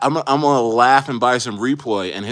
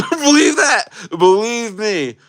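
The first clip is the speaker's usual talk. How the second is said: much higher, laughing insistence; 11 semitones higher, 5 dB louder, slower than usual. Real, not acted. Streamer laughing to chat, much higher. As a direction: laugh while swearing it's true.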